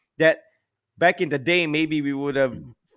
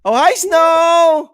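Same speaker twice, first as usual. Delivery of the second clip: excited high greeting; 11 dB louder, much slower than usual. Real, not acted. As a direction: stretch it out, high and delighted. Greeting a small child on call.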